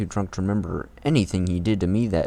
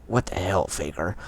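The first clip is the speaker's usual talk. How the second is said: Shadow voice retry; 3 dB quieter than usual. Shown as a demonstration. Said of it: "You tried".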